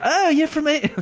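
put-on high-pitched girl with English accent; hammed-up English accent, way up high; mistaken English accent. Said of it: "the girls don't have English accents".

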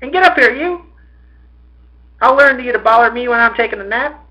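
high, loud angry mother; scolding yell. A narrator's reading. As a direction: call the son in sharply. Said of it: "without changing her tone, she called to her, her son".

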